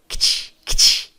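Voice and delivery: KT as two consonants with no vowel, just air; isolated KT cluster. Shown as a demonstration. Teacher isolates the ending of asked, voiceless KT. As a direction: click K straight into a hissed T. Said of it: "é o som".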